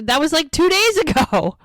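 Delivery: high-pitched, incredulous protest; retold disbelief. He hears the lab results are already back, incredulous. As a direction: climb high as if you can't believe it.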